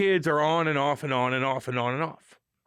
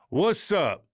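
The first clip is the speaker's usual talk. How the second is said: ordinary delivery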